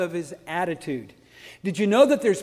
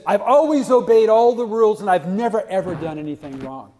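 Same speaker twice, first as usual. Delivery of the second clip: mock proud; 8 dB louder than usual. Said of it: "so proud of how good he was".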